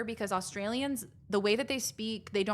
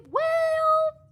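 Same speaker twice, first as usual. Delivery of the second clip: squeaky well; 8 dB louder than usual. Real, not acted. Playful protest.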